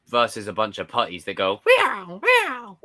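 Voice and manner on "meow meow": squeaky high cat meow; high mock meow. Imitating putties, very high.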